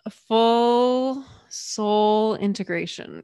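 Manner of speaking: drawn out, each word weighed; slow it right down for emphasis; slow and deliberate; slow, weighty. Real, not acted.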